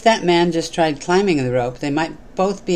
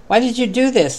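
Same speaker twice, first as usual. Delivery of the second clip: raised, higher voice calling down; 8 semitones higher, 3 dB louder than usual. A narrator's reading. Man on the bridge calls down to the dangling man, calling out. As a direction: call down the rope in alarm. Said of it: "the first man called out".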